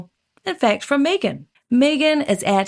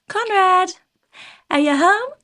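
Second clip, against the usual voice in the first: high call into a house; 8 semitones higher than usual. Acted. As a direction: call out to see who's home, own accent. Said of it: "she yells out to Conrad".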